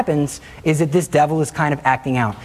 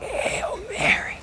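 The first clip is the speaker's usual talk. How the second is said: slow, high, strained whispered gasp; breathless pushed whisper; 8 dB quieter, much slower than usual. Retold or imitated. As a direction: force the words out through a choked throat. Forcing words out while choked.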